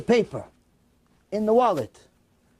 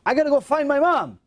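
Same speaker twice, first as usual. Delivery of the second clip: urgent resolve; 6 semitones higher, 3 dB louder than usual. Retold or imitated. Son suddenly resolves to act.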